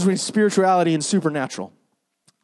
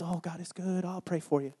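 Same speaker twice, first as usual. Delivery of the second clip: much softer, empty church phrase; mock hollow platitude; 13 dB quieter than usual. Mocking what churchgoers tell the starving, softly and emptily. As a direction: murmur it like a hollow cliché. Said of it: "all you can say is".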